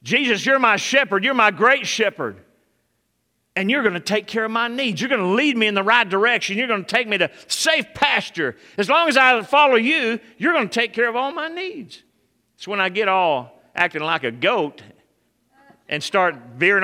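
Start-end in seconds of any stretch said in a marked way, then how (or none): 0.0-12.0 loud, high, pleading prayer; fervent prayer. Shown as a demonstration. Pastor modelling a prayer for the church, loud and high. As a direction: pray it out with full fervor.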